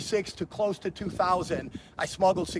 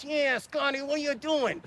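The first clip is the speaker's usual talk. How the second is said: put-on higher-pitched old mafia uncle voice, slowed down; 7 semitones higher than usual. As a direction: imitate your old mob uncle greeting his nephew. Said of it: "He talked like this".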